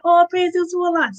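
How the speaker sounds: normal talking voice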